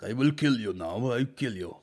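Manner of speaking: put-on light Eastern European villain accent; a hint of a movie baddie with an Eastern European accent. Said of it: "that sort of voice".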